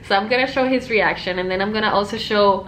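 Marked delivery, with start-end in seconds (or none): none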